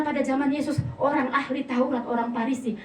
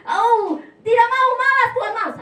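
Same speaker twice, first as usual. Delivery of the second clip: whiny refusal; 10 semitones higher, 7 dB louder than usual. Retold or imitated. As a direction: sulk and refuse like a stubborn child. Acted refusing God. (merengek menolak Tuhan)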